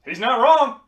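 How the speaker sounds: mock-booming big man; booming agreement. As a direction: boom it out like a huge man agreeing. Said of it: "this mountain of a man goes".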